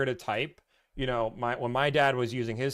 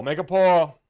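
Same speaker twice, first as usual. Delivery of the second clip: higher, louder repeat; loud call; 5 semitones higher, 9 dB louder than usual. Real, not acted. Streamer shouting the idea to the chat, higher and louder.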